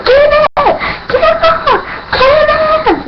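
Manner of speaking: very high squealed shrieks; stoked fan screaming. Retold or imitated. Fan at the show as Kenneth comes out, wildly excited. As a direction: squeal the name like a wild fangirl. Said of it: "I am stoked, I am wild out".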